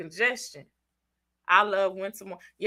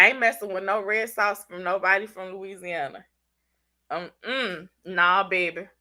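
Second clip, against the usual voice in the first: ordinary talk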